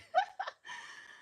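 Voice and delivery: high, breathless villain cackle; maniacal laugh. Real, not acted. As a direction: cackle like a supervillain. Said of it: "I might have a maniacal laugh".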